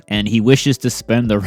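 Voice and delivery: ordinary delivery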